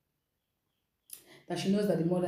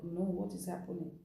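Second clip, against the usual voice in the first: hushed, much softer, frightened; scared; 8 dB quieter than usual. Retold or imitated. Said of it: "fear gripped her".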